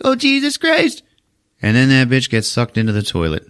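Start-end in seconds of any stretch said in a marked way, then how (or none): 0.0-1.0 very high frightened squeal; mock scared girl. The scared partygoer in the bathroom, high mock squeal. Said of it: "And she's like".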